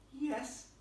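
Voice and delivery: put-on high girlish yes with mild confidence; mildly confident little girl. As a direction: answer high, with a touch of confidence. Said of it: "a little more confidently this time".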